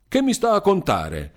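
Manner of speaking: sharp, high, indignant snap; brusque retort. A narrator's reading. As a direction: cut him off brusquely. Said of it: "interruppe con un brusco movimento delle mani".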